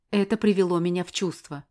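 ordinary delivery, nothing marked

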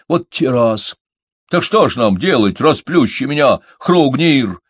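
high, loud fretting; agitated. A narrator's reading. Малыш asks his friends what to do, agitated. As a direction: blurt it out in alarm. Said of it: "взволновался малыш".

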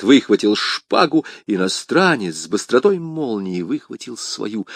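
usual speaking voice

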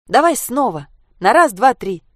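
rally a friend for another countdown; spirited shout. A narrator's reading. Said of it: "задорно крикнула Саша". (задорный громкий выкрик)

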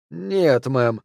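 ordinary delivery

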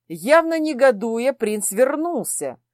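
plain reading voice

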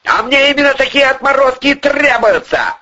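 high, forceful rebuff; heated, final-word answer; cutting retort. A narrator's reading. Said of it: "отрезал Артём Павлович".

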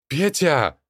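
strained high cry of a name; desperate shout. Acted. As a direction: shout after him in desperation. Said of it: "надрывно кричал он".

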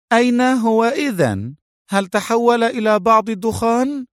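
high-pitched, piqued woman's voice; annoyed. A narrator's reading. Mona retorting after being called stupid, annoyed. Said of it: "وقالت بضيق".

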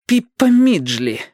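sullen, teeth-clenched reply; through gritted teeth. A narrator's reading. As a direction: squeeze the name out reluctantly. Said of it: "процедила она".

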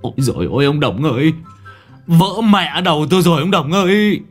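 rising to a high, loud wail; howling in pain. Acted. Hit on the head by a falling pot, howling loud and high. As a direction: yowl like a cat with its tail caught. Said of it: "Cậu rú lên cứ như là mèo bị kẹp đuôi".

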